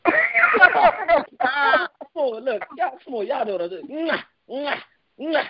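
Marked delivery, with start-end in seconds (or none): 0.2-2.1 high-pitched burst of laughter; high amused laugh. Real, not acted. Amused at the smacking rant. 3.9-5.4 soft, high mock smacking noises; imitated lip smacking. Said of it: "Why do they smack".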